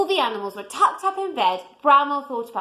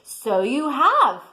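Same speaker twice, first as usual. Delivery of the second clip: put-on old bear voice; 4 dB louder, much slower than usual. A narrator's reading. Old Bear's slow reply.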